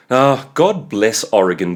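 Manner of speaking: ordinary delivery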